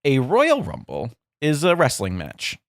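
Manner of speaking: normal talking voice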